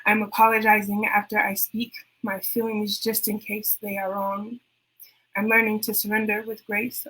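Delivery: measured spoken-word poem; spoken word poetry recital; poem reading. Acted. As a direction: read it like a performance poem, steady and inward. Reads her poem aloud.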